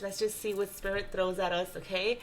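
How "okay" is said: usual speaking voice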